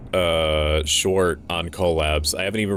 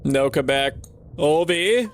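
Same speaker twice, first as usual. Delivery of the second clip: pleading shout for her son; desperate call; 9 semitones higher, slower than usual. Acted. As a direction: call out as he slips away. Mother calling vanishing son.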